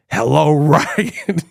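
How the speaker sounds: slow mock killer greeting through laughter; Ghostface voice. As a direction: drag out the hello like the masked caller. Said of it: "So he could be like".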